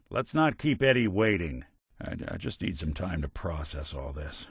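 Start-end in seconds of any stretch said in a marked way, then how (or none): 2.0-4.5 low, soft, halting admission; subdued. Acted. Detective needing time to process, subdued. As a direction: say it quietly, stumbling on the start.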